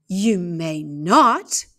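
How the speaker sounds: slow, loud, clipped-off no; stern refusal. Acted. Aunt cutting off the girl, slow and loud refusal. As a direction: lay down the law, word by word. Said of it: "said Aunt Alexandra".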